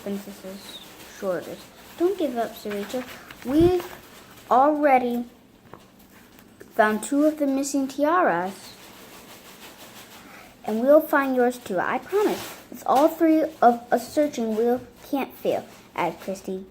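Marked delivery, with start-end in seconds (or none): 2.0-2.6 eager encouraging. Acted. Character encourages princess. 3.4-15.6 eager reassuring. Acted. Character reassures princess.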